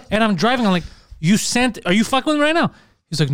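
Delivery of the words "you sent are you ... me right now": high, incredulous retelling; retold disbelief. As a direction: replay your disbelief, pitch jumping up. Retells his alarm while driving.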